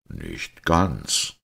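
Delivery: low-pitched, composed priest; calm confirmation. A narrator's reading. Pater Braun answers the agitated Simon, calmly confirming. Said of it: "bestätigte Pater Braun".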